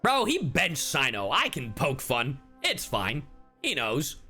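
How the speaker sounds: ordinary delivery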